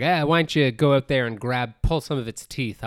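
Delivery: loud swaggering boss voice; loud boastful boss. Retold or imitated. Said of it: "And he's like".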